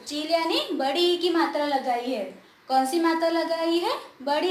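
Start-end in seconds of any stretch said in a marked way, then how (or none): none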